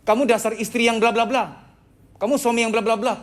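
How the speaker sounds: mock a spouse's angry name-calling; mock angry labelling. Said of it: "marah di dalam redaksi kalimat". (omelan marah pura-pura bernada tinggi)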